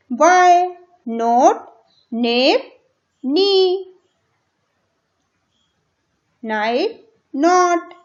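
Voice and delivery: each word called out loud and punchy; reading words aloud. Shown as a demonstration. Teacher reads the word list aloud for pupils to repeat. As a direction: bark each word out clearly for the class. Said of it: "आपको तेजी तेजी से बोलना है".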